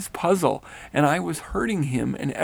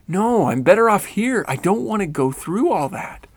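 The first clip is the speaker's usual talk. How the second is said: higher, louder pleading; desperate plea; 6 semitones higher, 7 dB louder than usual. A narrator's reading. Rennell pleading with Grandma to stay, higher and louder. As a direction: beg not to be sent back.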